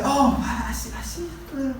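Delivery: mock surprised stranger; guessing stranger. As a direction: act out someone puzzling over his accent. People guessing his origin, quoted.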